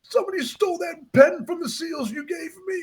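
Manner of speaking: high, choked-up boy imitation; lump in throat. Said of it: "he gets a lump in his throat".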